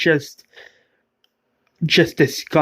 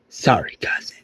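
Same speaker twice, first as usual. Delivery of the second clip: soft, unvoiced fake apology; low whispered sneer; mocking whisper; 5 semitones lower, 4 dB quieter than usual. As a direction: whisper the sorry with cruel mockery. Villain's mock apology.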